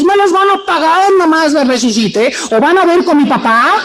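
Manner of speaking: high, hammed-up threat with a laugh; mock vengeful Jesus. Preacher imagines Jesus threatening the mockers, hammed-up revenge voice. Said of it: "en lugar de decirles".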